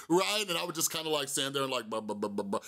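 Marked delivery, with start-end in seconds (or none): 1.9-2.6 low, mumbled buh-buh filler sounds; mumbled fake prayer. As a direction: fake the words under your breath. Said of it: "Just, like, move my lips".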